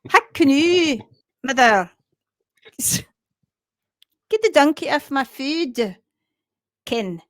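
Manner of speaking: hammed-up, halting Scottish accent; amateur mock-Scottish accent; attempted Scottish accent. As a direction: have a go at an indignant Scottish complaint. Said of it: "that was an attempt at, uh, a Scottish accent".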